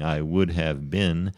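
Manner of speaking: slow "would have been", every word sounded; careful, unreduced "would have been"; full uncontracted form. Shown as a demonstration. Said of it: "which is the correct usage".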